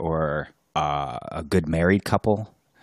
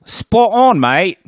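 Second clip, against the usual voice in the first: hammed-up high British accent, much higher and louder; mock British accent, pitched way up; 11 semitones higher, 9 dB louder, slower than usual. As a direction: play a cheery Brit, badly. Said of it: "I butchered your accent".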